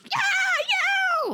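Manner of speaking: wordless-sounding tantrum howl; freaking out. Retold or imitated. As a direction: imitate a thrashing child's wild yell. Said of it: "Like, freaking out about this bracelet".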